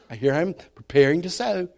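usual speaking voice

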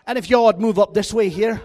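loud Southern twang breaking into the brogue; Scot-Irish slipping into Southern. Shown as a demonstration. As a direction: belt out a botched Irish-Southern accent. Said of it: "throw a little Southern in there".

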